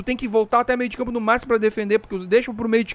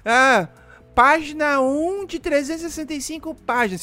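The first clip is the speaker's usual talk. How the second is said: high mocking voice of an annoying guy; mock the complainers in a higher pitch; whiny mock voice; 6 semitones higher than usual. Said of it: "Não aguento aqueles caras que já começam".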